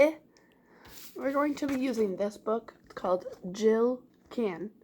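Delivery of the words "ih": "I" as a lone short vowel for repeating; short I sound. Shown as a demonstration. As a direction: model "I" like the vowel in pin. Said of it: "Can you repeat that sound".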